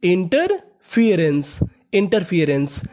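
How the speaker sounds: Interference stretched slowly; slow, careful demo. Shown as a demonstration. Teacher slowly pronounces interference for students. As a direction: drag out interference for the class. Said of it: "meaning क्या बन गई?".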